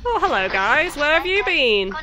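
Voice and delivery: ordinary talk, nothing marked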